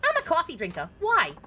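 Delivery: put-on high New York accent, brash; a brassy New York lady warning him. Passenger warns the driver, high New York accent. Voices female taxi passenger.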